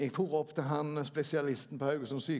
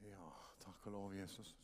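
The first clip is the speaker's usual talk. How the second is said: soft, low, murmured prayer; 7 semitones lower, 14 dB quieter than usual. Acted. Pastor praying over the congregation, soft and devout. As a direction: pray quietly and devoutly.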